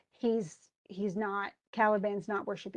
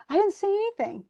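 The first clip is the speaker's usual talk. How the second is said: high, loud squeal of innocence; mock-offended, high-pitched denial; indignant protest; 10 semitones higher, 5 dB louder than usual. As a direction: protest like you've been wrongly blamed. Trinculo protests his innocence when blamed.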